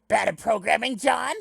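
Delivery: loud, high-pitched indignant character voice; Karkat. Acted. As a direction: challenge it loudly, pitch climbing to the end. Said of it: "it's been a while since I've done a Carcat".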